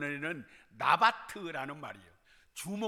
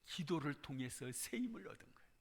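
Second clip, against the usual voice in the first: soft, unvoiced whisper of hope; 5 semitones lower, 11 dB quieter than usual. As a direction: drop to a whisper, intimate. Sermon turns softly intimate. (속삭이듯 조용히 전하는 말씀)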